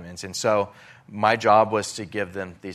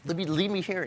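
pitched way up, pleading; arguing plea; 10 semitones higher than usual. Retold or imitated. Begging the pastor to leave him, high pleading protest. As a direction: insist again, higher, to stay. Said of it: "I'm arguing".

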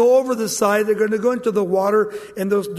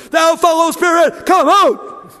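high, very loud drawn-out shout; mock a hammed-up exorcism at full volume; theatrical exorcist; 9 semitones higher, 10 dB louder, slower than usual. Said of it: "theatrical people, try to cast out demons".

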